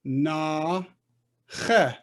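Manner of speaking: "na che" split by a pause; long "na", short throaty "che"; teacher sounding out "na ... che"; syllable-by-syllable demo. Shown as a demonstration. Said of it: "And this".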